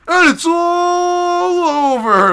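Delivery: very high, loud shout held long on "all"; mock TV announcer yell. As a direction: stretch it out like a screamed fight-ending call. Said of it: "And then we turn on the T V".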